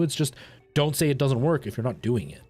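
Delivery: usual speaking voice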